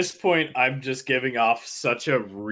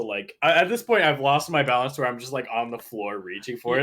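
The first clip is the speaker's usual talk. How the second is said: ordinary talk, nothing marked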